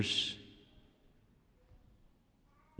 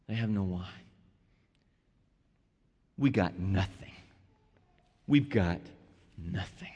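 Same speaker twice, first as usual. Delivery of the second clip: hushed helpless plea; 11 semitones lower, 9 dB quieter than usual. Acted. Preacher voicing Mary's helpless plea.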